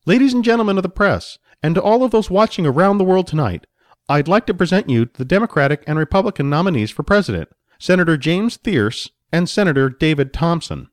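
a stage host welcoming the cameras; announcer-style mediator. Acted. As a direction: introduce the candidates to the press with flourish.

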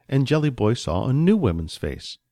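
usual speaking voice

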